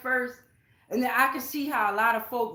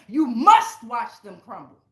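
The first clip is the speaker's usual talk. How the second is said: forceful loud; 9 dB louder than usual. Real, not acted. Declaring enemies' downfall.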